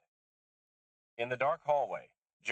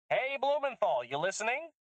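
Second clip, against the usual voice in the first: higher, louder taunting call; 4 dB louder than usual. Acted. Susan daring the press to listen, loud taunting call. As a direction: jeer at whoever is tapping the line.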